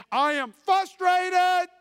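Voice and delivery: frustrated shouted high and slow; a husband's pained outcry; anguished shout. Real, not acted. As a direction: cry it out from the gut.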